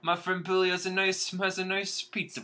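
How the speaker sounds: high-pitched, hammed-up Brooklyn accent. Rat recommending a friend's pizza place, exaggerated Brooklyn accent. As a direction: chat away in a cartoon Brooklyn accent. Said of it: "If a Brooklyn".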